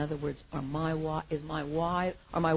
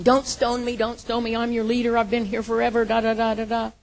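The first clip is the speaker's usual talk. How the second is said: pretend yelling; 5 semitones higher, 9 dB louder than usual. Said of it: "start yelling and screaming".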